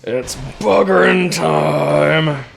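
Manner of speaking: slow, loud drawn-out battle cry; hammy shout. Pretend. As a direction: roar it out slowly. Shouted joke catchphrase.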